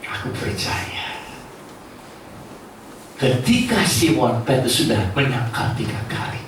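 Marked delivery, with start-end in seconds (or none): 0.1-1.2 slow, hushed, almost a whisper; whispered confession. Shown as a demonstration. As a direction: say it softly like a private vow. Model confession of faith.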